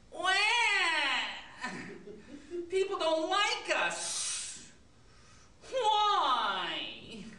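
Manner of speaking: high, slow wailing whine; crying. Acted. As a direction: wail like a hurt child. Rejected snakes lament.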